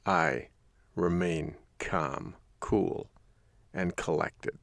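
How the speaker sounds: soft, slow, spaced-out words; calm and collected. Shown as a demonstration. Host describing himself in a crisis, calm. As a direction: let the voice sink, cool and composed.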